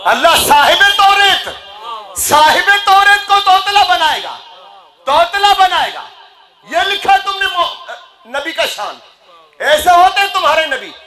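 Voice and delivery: high, forceful sermon rebuke; impassioned, high-pitched preacher's scolding; indignant preaching. Real, not acted. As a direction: cry out in outrage to the congregation. Preacher in full cry, indignant rebuke.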